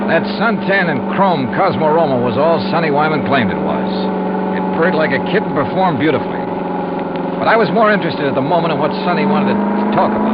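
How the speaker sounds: steady first-person detective narration; story narration. A narrator's reading. Detective narrating his case to the audience, in an even, relaxed storyteller's voice. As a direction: tell it to the listener like a private eye's report.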